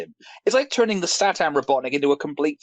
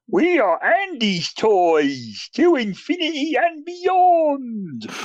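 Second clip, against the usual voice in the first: very slow, high, drawn-out cartoon voice; Buzz Lightyear parody; 11 semitones higher, much slower than usual. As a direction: stretch each word out absurdly, climbing higher. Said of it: "Buzz talks like this".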